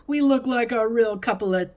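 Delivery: loud, playful tough guy. A narrator's reading. Grandma clowning with a grape-leaf mustache, tough guy voice. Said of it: "in a tough guy voice".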